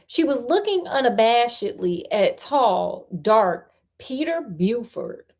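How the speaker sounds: ordinary delivery, nothing marked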